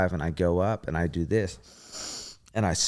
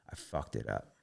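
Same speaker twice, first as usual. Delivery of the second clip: much lower and softer, defeated; low dejected mutter; 10 semitones lower, 13 dB quieter than usual. Retold or imitated. Gymnast thinking he blew the landing, low and quiet. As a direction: say it to yourself, crushed. Retold thought after dismount.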